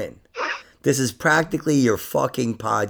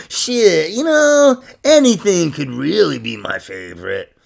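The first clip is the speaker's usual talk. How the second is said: high drawn-out know; mock philosophical musing; 4 semitones higher, slower than usual. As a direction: play the dreamy philosopher.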